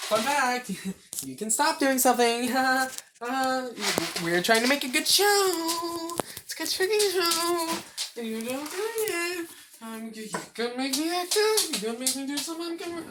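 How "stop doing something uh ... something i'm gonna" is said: higher mock telling-off; playfully sassy reprimand; mock scolding. Scolding her friend for messing up the show, higher and sassy.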